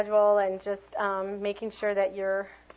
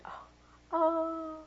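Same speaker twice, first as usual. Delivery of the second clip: sad oh oh; 8 semitones higher, 4 dB quieter than usual. Shown as a demonstration. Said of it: "isn't that sad".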